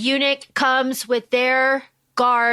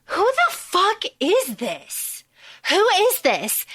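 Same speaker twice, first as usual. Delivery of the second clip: slow, high-pitched disbelief; incredulous, stretched-out demand; bewildered prince; 6 semitones higher, slower than usual. Acted. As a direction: draw out the question, pitched up. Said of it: "Prince Juan is like".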